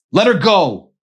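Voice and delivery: loud, high strained shout; ragged shout. Acted. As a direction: tear it out of weak lungs. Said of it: "his voice ripping through weak lungs".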